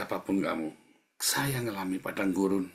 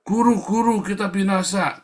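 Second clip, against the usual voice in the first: high, loud cry of alarm; panicked yell; 11 semitones higher, 8 dB louder than usual. Acted. Disciples yelling to Jesus in the storm, panicked shout. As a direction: call out in panic to the teacher. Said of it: "dia teriak-teriak".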